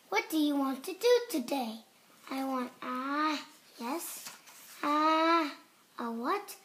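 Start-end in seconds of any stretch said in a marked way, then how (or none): none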